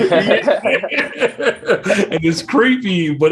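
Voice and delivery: laughing. Real, not acted. Laughing at the announcer impression.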